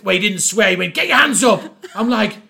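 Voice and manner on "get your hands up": barked cop order, louder; hammed-up hotel cop command; mock police shout. Hotel cop drawing a taser.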